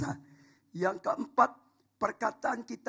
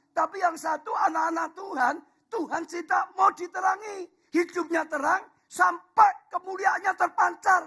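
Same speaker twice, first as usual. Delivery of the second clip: rising, loud proclamation, higher and louder; full-force preaching; 10 semitones higher, 7 dB louder than usual. Acted. Pastor to the congregation, proclaiming at full force. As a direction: preach it out to the whole church. Preaching about light and darkness.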